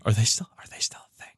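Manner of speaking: hushed aside, breathy whisper. Real, not acted. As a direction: whisper it like a secret. Whispered aside about band.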